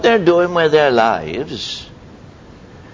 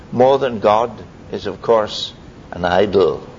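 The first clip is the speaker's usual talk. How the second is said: ordinary talk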